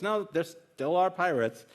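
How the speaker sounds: mildly acted stubborn insistence; insistent contradiction. Joke character insists against doubter.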